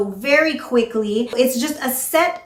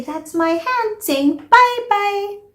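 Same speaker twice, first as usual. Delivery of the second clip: much higher, slow, playful; high kid voice; 10 semitones higher, slower than usual. Shown as a demonstration. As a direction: say it sweetly to a small child. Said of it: "point to the hand and say".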